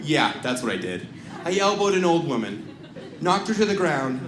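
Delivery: usual speaking voice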